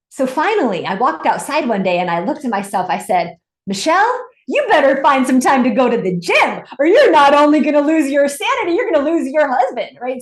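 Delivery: high, loud mock pep talk to herself; self-scolding Michelle. Imitating Michelle lecturing herself about the gym, high and loud. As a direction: scold yourself into going to the gym. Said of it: "then she catches herself".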